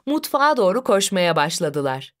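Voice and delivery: ordinary delivery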